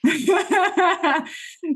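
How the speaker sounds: loud high laugh; high burst of laughter. Real, not acted. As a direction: crack up at your own joke. Laughing at own joke.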